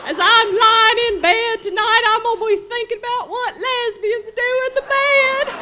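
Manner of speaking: very high, loud, giddy Southern belle; Southern lady voice. Retold or imitated. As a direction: squeal it out like a naughty Delta lady. Charlotte's giddy reply.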